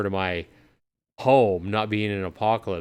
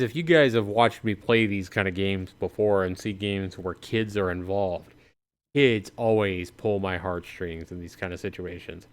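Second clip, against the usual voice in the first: ordinary talk, nothing marked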